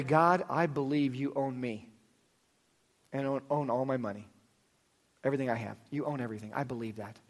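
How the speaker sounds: soft, hushed prayer voice; quiet prayer. Acted. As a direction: pray it softly and sincerely. Leads a prayer.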